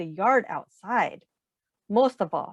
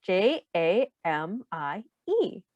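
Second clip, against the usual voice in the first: slow, letter-by-letter spelling; spelling a name for children; spelled out letter by letter; 3 semitones lower, 4 dB quieter, slower than usual. Shown as a demonstration. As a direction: spell each letter out slowly. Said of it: "J for Jamie".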